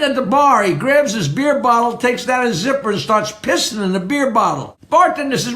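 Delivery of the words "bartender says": ordinary talk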